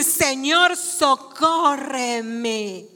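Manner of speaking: drawn-out plea for rescue; slow, beseeching appeal, falling away; mild imploring cry. Acted. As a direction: stretch the cry for help very slowly. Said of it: "además de que ella había gritado".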